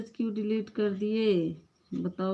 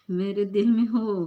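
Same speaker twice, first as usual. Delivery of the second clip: loud, amused "मेरे दिल में हो?"; a teasing, delighted exclamation; surprised; 2 semitones higher, 8 dB louder than usual. Real, not acted. Reacting loudly to "दीदी, हम आपके दिल में हैं", surprised. Playful surprise at being told she's in someone's heart.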